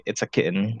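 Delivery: soft American-accented "kitten", second vowel swallowed; quiet Americanized "kitten" with a swallowed ending. Shown as a demonstration. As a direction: say "kitten" as "kit'n", softly, American style. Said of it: "when I talk with them, I say".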